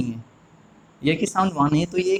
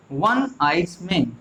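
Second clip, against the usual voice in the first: slow model reading; much slower than usual. Shown as a demonstration. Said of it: "पहले fourteen number sentence को बनाइए।".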